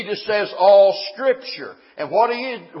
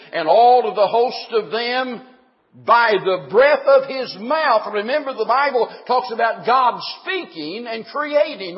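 louder, rising preacher's proclamation; full-force preaching; 4 semitones higher, 5 dB louder than usual. Acted. Preacher quoting Psalms, building up.

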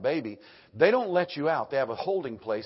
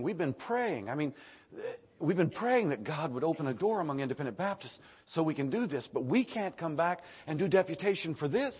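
ordinary talk, nothing marked

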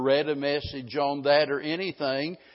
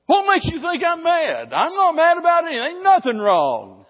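much higher, louder, faster mock angry denial; hammed-up spouse insisting they're not mad; shouting that nothing is wrong while clearly mad; raised voice denial; 14 semitones higher, 9 dB louder than usual. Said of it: "we raise our voice".

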